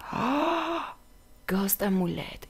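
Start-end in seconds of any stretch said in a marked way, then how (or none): 0.1-0.8 drawn-in, hushed "oh" of awe; soft high-pitched gasp; high amazed gasp. Pretend. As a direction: gasp in wonder at a find. Gasp at a discovery.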